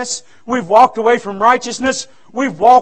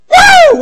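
loud, very high "woo"; exultant whoop; 9 dB louder than usual. Real, not acted. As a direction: whoop like a rejoicing preacher. Celebrating leaving at the trumpet.

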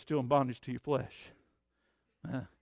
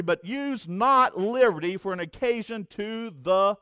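raised, high preaching cadence; fervent preaching; 6 semitones higher, 9 dB louder than usual. Acted. Pastor exhorting believers to live rightly, fervent. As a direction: drive each phrase home.

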